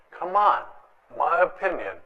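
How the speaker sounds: normal talking voice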